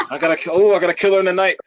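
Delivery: ordinary delivery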